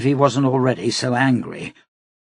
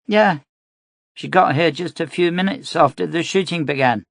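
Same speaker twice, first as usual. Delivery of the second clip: put-on a little girl answering eagerly; young girl; 7 semitones higher than usual. A narrator's reading. Child voice in story.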